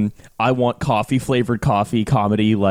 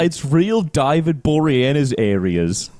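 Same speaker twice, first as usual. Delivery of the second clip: slow, broad fake Australian; hammed-up Australian accent; 3 semitones higher than usual. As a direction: stretch every vowel in mock Aussie. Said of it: "doing his accent".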